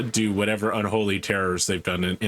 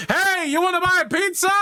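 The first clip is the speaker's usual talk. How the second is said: very high squeaky hawker call; high pizza vendor voice; 3 dB louder than usual. Acted. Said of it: "somebody's like".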